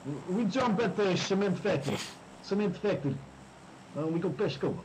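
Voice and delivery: high, hammed-up Russian accent; mock Russian accent. Calling the jump spot to his teammate in a mock Russian accent. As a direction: play it like a broad Russian squad leader. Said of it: "I think we have new accent for this game".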